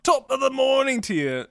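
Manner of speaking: high, chirpy fake British greeting; mock British accent. Co-host greeting fiance after waking changed, high mock British accent. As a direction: sing out a hammy British good-morning. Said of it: "if I woke up with a British accent".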